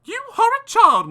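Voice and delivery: high, loud scolding mother; a high, loud, furious mother; angry cry. Acted. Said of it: "my mother cried".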